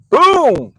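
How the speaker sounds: loud, high mock crash; boom sound effect. Comedian acting out his fall, loud boom effect. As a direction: punch out the crash noise.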